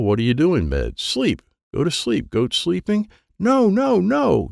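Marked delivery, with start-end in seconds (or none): 3.4-4.5 high, loud no no no; frantic no. A narrator's reading. Boy frantically correcting his cousin's guess. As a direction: cut them off, they're wrong.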